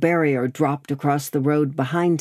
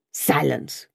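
growling red cap. A narrator's reading. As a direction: growl it as a rough patriot ordering silence. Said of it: "growled a red cap".

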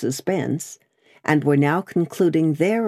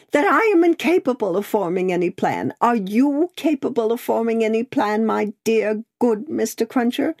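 high, pleading distress, understated; distraught crying; 7 semitones higher than usual. A narrator's reading. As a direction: beg for help, mildly tearful. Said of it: "wildly crying".

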